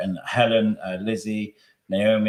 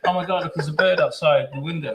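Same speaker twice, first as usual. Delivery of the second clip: lightly acted fake distraction, a bit higher; mock distracted pretending; 6 semitones higher, 4 dB louder than usual. A host hides his emotion on air, mock distracted. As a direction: pretend something outside caught your eye. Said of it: "pretending to look at my phone and stuff".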